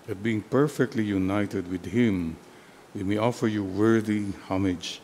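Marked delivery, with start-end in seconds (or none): none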